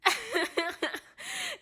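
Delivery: loud, high cackling laugh; high burst of laughter. Real, not acted. Laughing at her own joke to viewers, loud laugh. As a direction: let a big laugh burst out.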